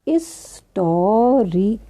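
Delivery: हिस्टोरिकल slowly as "is-to-ri-kal", silent h; drawn-out teacher's हिस्टोरिकल beginning on "is"; sounded out. Shown as a demonstration. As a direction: break हिस्टोरिकल into slow syllables without the h. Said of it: "कैसे पढ़ते हैं".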